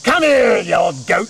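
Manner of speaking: put-on old witch calling her goat into the house. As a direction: holler for the goat to come in. Berchta grabs the goat.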